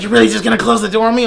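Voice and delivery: high, fast imitation of an offended girl; mimicked indignant protest. Girl walking out protests the closing door, mock indignant.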